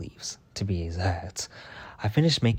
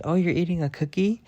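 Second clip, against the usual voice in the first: higher-pitched mock question; imitating coworker; 8 semitones higher than usual. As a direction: imitate her asking, lifting high at the end. Quoting coworker asking about cookie.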